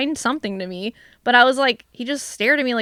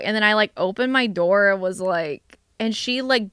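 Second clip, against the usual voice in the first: ordinary talk, nothing marked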